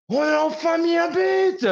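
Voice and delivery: hurlé très aigu et lentement, comme le supporter; cri de joie. Retold or imitated. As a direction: imiter le fan qui explose de joie au but. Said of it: "se retourne en hurlant et crie". (le supporter d'Aston Villa hurle enfin son bonheur, cri aigu et traînant)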